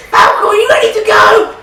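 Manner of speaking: high, loud call; shouting from the car. Acted. Cass shouting to Falco from the car, high and loud. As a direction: yell it from the driver's seat. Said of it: "Cass shouted".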